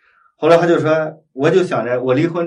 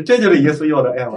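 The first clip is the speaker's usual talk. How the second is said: ordinary talk, nothing marked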